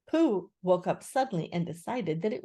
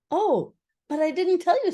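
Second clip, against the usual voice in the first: high, loud, lively voice; chirpy Rabbit; 11 semitones higher, 6 dB louder than usual. A narrator's reading. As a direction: break the news brightly. Rabbit breaks news to Eeyore.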